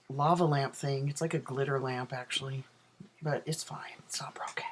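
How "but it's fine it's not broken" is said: whispered aside. Real, not acted.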